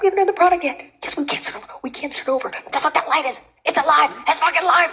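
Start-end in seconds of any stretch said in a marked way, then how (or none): 1.0-2.5 tense hush. Acted. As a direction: whisper it so the cameras miss it. Hushed on live set.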